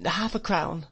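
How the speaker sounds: put-on high, small young voice; boy recovering himself. A narrator's reading. Boy naming his price to the old man, nervous high voice. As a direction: answer timidly, pitched well up. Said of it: "I answered, recovering myself".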